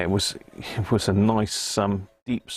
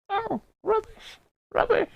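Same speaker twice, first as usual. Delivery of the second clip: penguin puppet voice. Acted.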